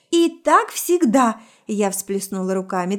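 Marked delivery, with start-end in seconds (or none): 0.1-1.4 high, loud, drawn-out exclamation; raised-voice quarrel, words stretched; exasperated outburst. Acted. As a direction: throw it out in angry exasperation.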